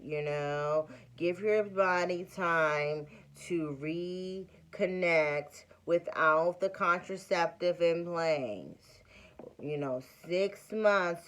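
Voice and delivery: very slow, soothing ad-pitchman doctor; drawn-out calm like an eye-drop commercial; Clear Eyes guy. Retold or imitated. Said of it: "he talks like the, the Clear Eyes guy".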